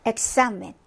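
엑사멘 with a k stop then a tense s; 엑사멘, closing on k before the s. Shown as a demonstration. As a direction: say 엑사멘 as ek-samen. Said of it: "이게 바로 크스 발음".

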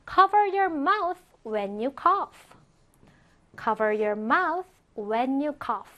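slow, careful teacher's English; drawn-out, evenly spaced words; slow model reading. Shown as a demonstration. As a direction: read it slowly for learners to follow. Teacher models sentence twice.

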